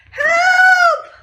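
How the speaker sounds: very high, loud screaming help; terrified child shouting; shouted. Acted. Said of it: "shouted Eddie".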